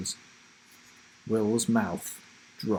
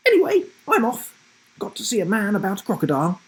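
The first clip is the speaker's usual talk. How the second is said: high, fast, cheery; breezy grandma; 10 semitones higher, 5 dB louder, much faster than usual. Acted. Grandma announces she's leaving, fast high cheer. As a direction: rattle it off breezily. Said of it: "she announced".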